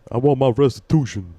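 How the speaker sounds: playful imitation of an Alabama-born commentator; Shannon Sharpe impression. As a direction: mimic a famous sports pundit's Southern accent. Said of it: "I don't know if it's racist to do the accent".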